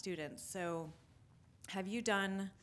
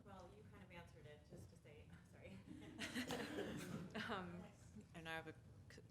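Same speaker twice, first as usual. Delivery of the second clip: talking through a mouthful of cookie; eating while talking, words round a bite; mouth full; 2 semitones higher, 8 dB quieter, faster than usual. Real, not acted. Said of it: "cookie in my mouth".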